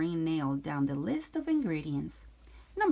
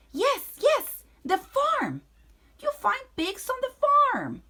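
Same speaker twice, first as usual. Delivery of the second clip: high, loud thrilled witch; excited witch; 9 dB louder than usual. Acted. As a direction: squeal it with glee.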